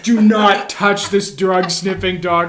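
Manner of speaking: loud, slow imitation of a guard; hammed-up security warning; mock stern command. Acts out guard's scolding command.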